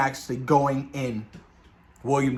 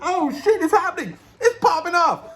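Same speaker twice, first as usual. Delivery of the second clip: very high, laughing excitement; excited squeal; 4 dB louder than usual. Retold or imitated. Fan seeing old villains appear, in a high excited squeal. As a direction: replay a thrilled outburst, pitch shooting up.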